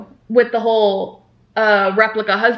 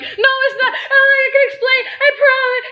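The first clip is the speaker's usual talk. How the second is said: very high, hammed-up tearful pleading; mock crying. Imitating a crying woman pleading to explain, high mock sobbing. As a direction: wail a pretend plea through laughter. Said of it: "she's, like, crying".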